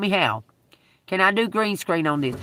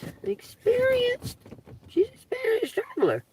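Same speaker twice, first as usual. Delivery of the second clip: "experienced" pitched way up in a mock sing-song; sarcastic high mockery. Mocking the woman who showed up, voice climbing high on "experienced". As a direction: sneer at the word "experienced" from up high.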